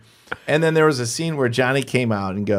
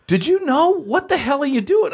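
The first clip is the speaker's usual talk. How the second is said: imitated high, incredulous reprimand; angry scolding. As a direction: act out a manager tearing into the performer. Manager scolds performer, retold.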